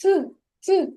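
«Т, т» said as a short hard t sound, repeated; isolated sound. Shown as a demonstration. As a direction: tap out «Т, т» crisply. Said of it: "Який же ж звук Т". (чітко вимовлений звук «Т»)

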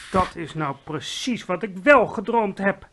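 mild exclamation; a touch of astonished outcry; exclaiming. Acted. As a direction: cry it out in amazement. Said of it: "riep de koningin uit".

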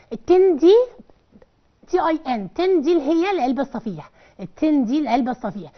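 ordinary delivery

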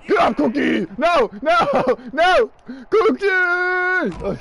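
high, loud repeated cries; panicked yelling. Real, not acted. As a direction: yell in mounting panic. Panicked yelling during game fight.

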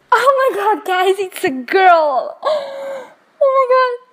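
high, teary gasp of joy; emotional excitement. Real, not acted. Seeing the newborn girl, teary high excitement.

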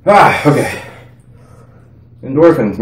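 ordinary talk, nothing marked

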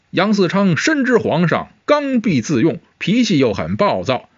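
ordinary delivery, nothing marked

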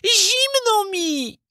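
very high, drawn-out plaintive cry; hungry puppet boy calling. Acted. Hungry puppet boy calls out in the empty cottage, high and plaintive. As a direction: wail out the hunger slowly. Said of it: "zawołał po raz drugi".

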